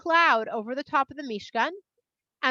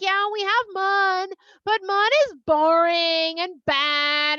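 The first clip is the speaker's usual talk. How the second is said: higher, slow whiny voice; whining complainers; 6 semitones higher, 4 dB louder, slower than usual. Acted. People whining about mun to Moshe, high and sulky. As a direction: sulk about the boring food.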